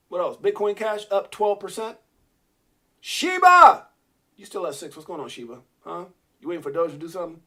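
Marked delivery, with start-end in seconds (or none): 3.0-3.9 high, loud shout of the name; loud hailing shout; calling out. Real, not acted. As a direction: call out the coin like a friend. Calling out to a coin.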